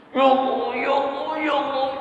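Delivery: put-on high pleading child's whine; preacher acting out an insistent whining prayer, high whine; whining plea. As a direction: beg like a crying child asking its parents. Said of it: "Yuleh, begitu tuh ... doanya begitu".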